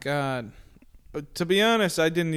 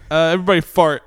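commanding shout; 10 dB louder, faster than usual. Pretend. Mind-control commands.

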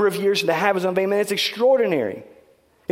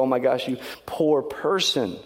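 mock pity; 5 semitones lower, 4 dB quieter, slower than usual. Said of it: "it's not that".

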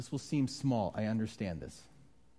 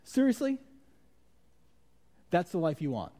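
high, louder jolt of disbelief, then settling; incredulous; 4 semitones higher, 3 dB louder than usual. Acted. God confronting an irritated driver, incredulous. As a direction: challenge the listener in disbelief. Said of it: "He's going".

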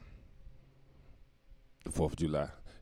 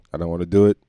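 louder, whining I don't wanna do it; loud refusal; 8 dB louder, much faster than usual. Acted. Host refusing to talk politics, loud whine. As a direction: protest it loudly like a child. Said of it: "I'm not gonna talk about politics".

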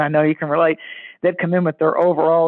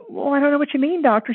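higher-pitched, lightly voiced skit patient; confused patient; 7 semitones higher than usual. Comedy-skit patient puzzled by the psychologist, confused. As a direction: protest to the doctor in a raised voice.

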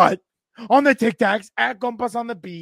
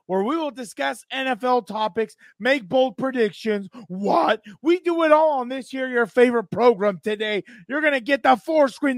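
ordinary talk

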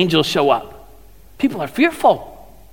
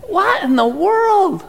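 slow, much higher amazed question; drawn-out high astonishment; 11 semitones higher, slower than usual. Retold or imitated. Preacher voicing a stunned disciple at the tomb, slow and high.

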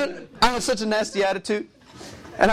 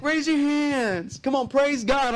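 high, drawn-out worship leader call; worship leader hype; 8 semitones higher, much slower than usual. Retold or imitated. Worship leader urging the crowd, high and slow. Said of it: "they're all like".

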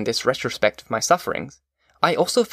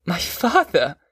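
higher-pitched cry of relief; astonished cry; 5 semitones higher, slower than usual. A narrator's reading. Son learning that his visitor is his father, astonished cry. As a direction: cry it out, lifted in sudden joy. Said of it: "cried I".

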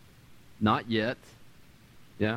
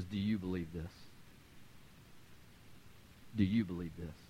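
soft searching question; 8 semitones lower, 10 dB quieter than usual. Retold or imitated. Jesus quietly asking Martha if she believes. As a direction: lower and softer, asked twice gently. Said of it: "He says".

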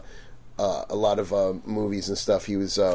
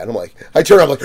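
ordinary talk

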